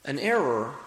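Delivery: ordinary talk, nothing marked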